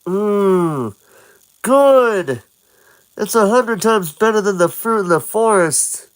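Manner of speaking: high delighted mmm; savoring food. Acted. Link eats, high and delighted. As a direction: relish every bite. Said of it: "Link starts to eat".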